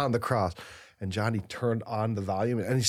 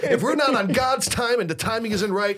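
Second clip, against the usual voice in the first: preachy priest voice; 9 semitones higher, 4 dB louder than usual. Retold or imitated. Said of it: "he's like, you know".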